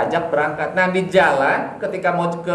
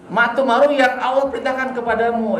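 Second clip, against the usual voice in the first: put-on higher, earnest young voice; obedient son; 8 semitones higher than usual. Preacher voicing Ismail agreeing with his father, higher and earnest. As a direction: answer like a devoted boy.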